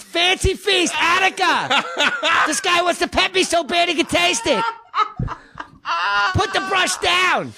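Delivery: very high, shouted Pacino as a cat; young Al Pacino. Acted. Comic as Pacino's fussy cat, high shouty impression. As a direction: screech it out like Pacino playing a pet. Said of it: "That's a cat saying it".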